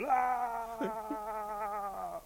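long high-pitched scream; a sustained storyteller's scream for the stranded rabbit. A narrator's reading. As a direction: hold one long, high cry.

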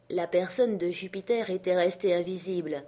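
ordinary delivery, nothing marked

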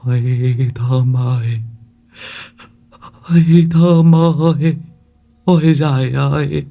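put-on old man keening through tears; tearful blubbering. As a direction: wail in self-pity, dragging every word. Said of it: "Ông cụ mếu máo".

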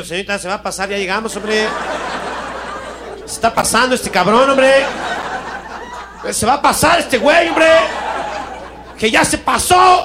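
building from hushed to high laughing alarm; rising panic. Retold or imitated. Passenger warning the stewardess the plane will overshoot, panicking upward. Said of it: "Y yo".

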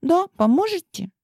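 put-on very high, coaxing girl voice; pleading princess. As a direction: coax them like a spoiled girl. Clementine begs the boys.

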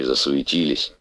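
ordinary delivery

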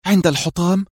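high-pitched surprised question; astonished. A narrator's reading. Heroine learns the chosen hiding place, astonished. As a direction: lift the pitch sharply in surprise. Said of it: "هتفت بدهشة".

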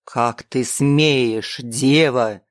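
low, slow, wrathful angel voice; deep booming rebuke; angry archangel. A narrator's reading. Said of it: "лицо вдруг преломилось гневом".

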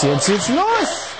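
mock kickoff. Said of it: "Stimmt aber nicht, war gelogen".

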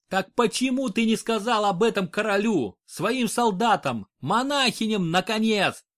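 high, heated outburst; exploding in anger. A narrator's reading. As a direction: burst out at him. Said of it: "Взорвался Альфорка".

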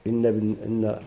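ordinary delivery

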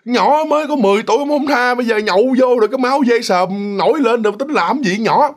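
an aunt fuming about her husband; indignant outrage. Retold or imitated. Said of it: "bà Mợ bà nghĩ".

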